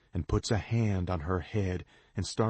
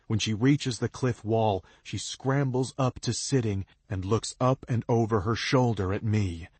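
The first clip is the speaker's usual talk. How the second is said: ordinary delivery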